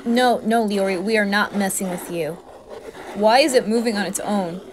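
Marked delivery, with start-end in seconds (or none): none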